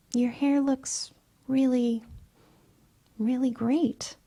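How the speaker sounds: a hint of a worried girlfriend soothing. She soothes upset partner.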